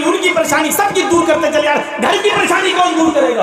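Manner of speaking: scolding wife. Retold or imitated. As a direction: voice the wife giving him an earful, pitch up. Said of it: "बीवी चढ़े जा रही है".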